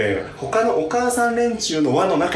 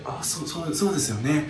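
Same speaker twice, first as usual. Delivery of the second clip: stunned weak reply; 7 dB quieter than usual. Retold or imitated. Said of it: "血の気引いて顔青いの".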